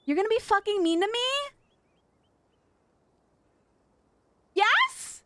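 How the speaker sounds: hammed-up whiny pout; mock whine. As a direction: whine it up high at the chatter.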